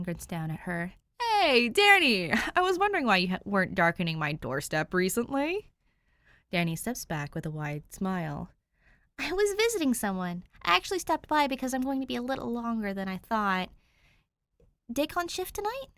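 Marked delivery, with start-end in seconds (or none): 1.2-5.7 the tattoo man, pleased to see her; cheery big doorman. A narrator's reading. Narrator voices Dave. 9.2-15.9 put-on a higher, brighter girl's voice; lightly voiced teen girl, casual; Danny asks Dave about Dick, lightly higher; young Danny, lightly voiced. A narrator's reading.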